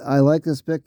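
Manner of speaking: ordinary delivery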